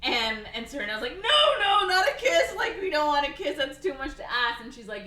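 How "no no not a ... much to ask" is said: loud, very high mock protest; hammed-up panicked shout with a laugh; frantic objection. Cyrano frantically objects to the kiss.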